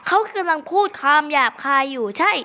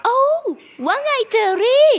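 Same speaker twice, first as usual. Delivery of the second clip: squeaky greeting; 10 semitones higher, 3 dB louder than usual. Pretend. Greeting in character voice.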